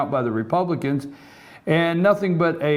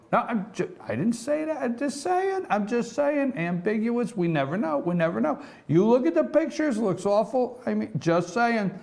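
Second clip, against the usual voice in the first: mock innocent backpedal; 8 semitones higher than usual. Host disowning his own jab at a duo, mock innocent. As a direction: squeal a playful denial up high.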